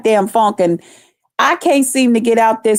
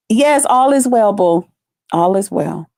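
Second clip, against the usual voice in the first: ordinary talk, nothing marked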